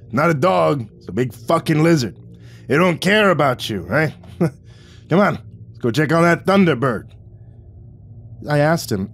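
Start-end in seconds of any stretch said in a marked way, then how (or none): 0.1-7.1 exasperated Vic. A narrator's reading. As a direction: complain in rough exasperation. Vic keeps talking.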